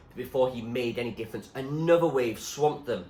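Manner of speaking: ordinary delivery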